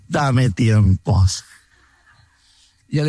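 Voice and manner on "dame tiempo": slow, lower, dragging "Dame tiempo"; reluctant stalling. Retold or imitated. Preacher voicing everyone's excuse to God, slow reluctant drag. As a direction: stall like someone who doesn't want to do it. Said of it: "la frase típica de todos nosotros".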